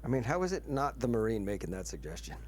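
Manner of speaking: normal talking voice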